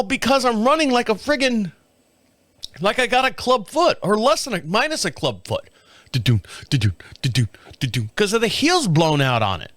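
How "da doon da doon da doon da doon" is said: low, bumpy da-doon sound; limping rhythm noise. Retold or imitated. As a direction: mimic a lopsided limp. Imitating limping gait.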